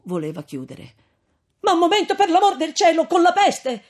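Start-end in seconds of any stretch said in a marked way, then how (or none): 1.6-3.8 put-on loud, high pleading young man; frantic, pleading call up to a window; desperate plea. A narrator's reading. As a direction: beg her not to shut the door. Renzo pleads again.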